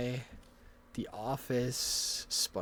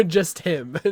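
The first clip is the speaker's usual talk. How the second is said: laughing; 4 semitones higher, 10 dB louder than usual. Real, not acted. Laughing at pronouns joke.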